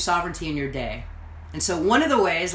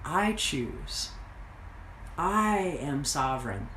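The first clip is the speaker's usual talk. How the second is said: quiet affirmation; 4 semitones lower, 7 dB quieter, slower than usual. Shown as a demonstration. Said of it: "I remind myself".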